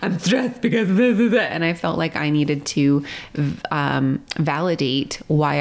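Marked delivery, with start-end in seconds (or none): 0.0-1.5 defensive outburst. Retold or imitated. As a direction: build up, upset and defensive. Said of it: "I was like".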